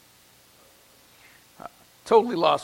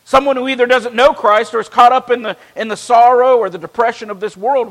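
raised, ringing pulpit voice; fervent preaching; 6 semitones higher, 10 dB louder than usual. Acted. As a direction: preach it out to the congregation. Preaching at full force.

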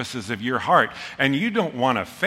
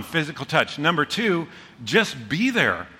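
ordinary talk, nothing marked

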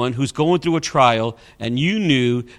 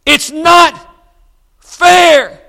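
high, loud, breaking shout; louder, rawer, desperate shout; near-shout; 12 semitones higher, 8 dB louder, much slower than usual. Retold or imitated. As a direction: shout it out as she begins to cry. Mother repeats, standing her ground.

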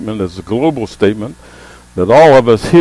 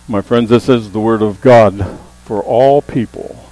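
ordinary delivery

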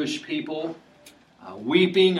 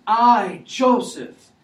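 high, loud cry of revelation; dramatic reveal; 10 semitones higher, 9 dB louder, much slower than usual. Acted. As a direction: call it out as Joseph unmasking himself. Joseph reveals himself to brothers.

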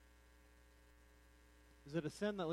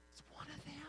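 high, very soft put-on voice; hushed accuser; 9 semitones higher, 13 dB quieter, faster than usual. Servant girl murmuring to bystanders about Peter, hushed accusation. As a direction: point him out in a high whispery voice.

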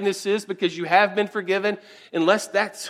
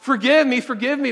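raised, pleading forgive me, forgive me; a debtor crying out for mercy; 6 semitones higher, 4 dB louder than usual. Retold or imitated. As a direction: beg the master out loud. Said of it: "He cries out".

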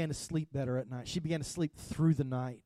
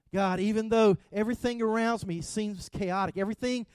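loud, high, driving sermon voice; full-force preaching; 5 semitones higher, 5 dB louder than usual. Acted. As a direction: preach it out to the whole room.

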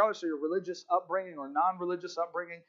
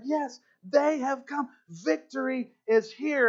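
high, slow, ringing exclamation; jubilant celebration; 7 semitones higher, slower than usual. Retold or imitated. Rough men hugging each other at the news, jubilant. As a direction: savour each word in triumph.

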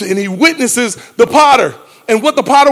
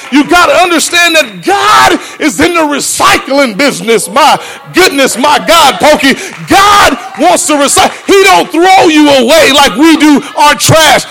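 high, loud preaching build; preacher shouts that God recycles people; full-force preaching; 6 semitones higher, 4 dB louder than usual. Acted. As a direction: preach it up loudly.

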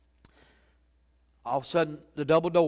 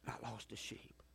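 breathy whisper, much softer; whispered repeat; 4 semitones lower, 19 dB quieter than usual. Shown as a demonstration. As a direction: drop to a whisper to let it sink in. Repeated line, then whispered.

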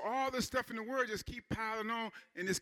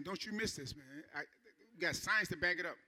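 ordinary talk

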